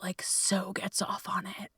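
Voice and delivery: sudden whisper, a naughty secret; whispered confession. Real, not acted. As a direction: drop to a breathless whisper as if admitting something. Confessing a guilty pleasure.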